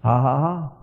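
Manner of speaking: low, joyless ha-ha-ha; forced laugh. Acted. Preacher showing the laugh God asked for, forced and joyless. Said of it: "I want you to laugh".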